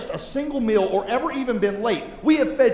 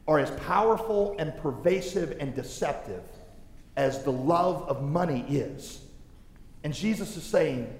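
usual speaking voice

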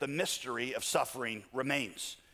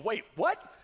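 higher, bewildered protest; baffled outcry, slowing on the question; incredulous questioning; 5 semitones higher than usual. Acted. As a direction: ask it in stunned disbelief, pitch leaping up. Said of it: "you should be going".

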